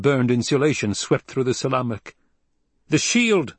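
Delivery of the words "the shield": high, loud alarm; shout. A narrator's reading. Sardaukar officer shouting an alarm to the Emperor. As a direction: yell the warning. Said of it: "officers shouted".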